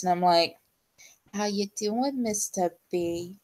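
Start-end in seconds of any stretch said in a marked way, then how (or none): 1.3-3.4 soft, flirty henchwoman voice; Harley Quinn. Retold or imitated. Picking on a crush after stream, soft Harley Quinn voice. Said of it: "I'm doing the Harlequin voice".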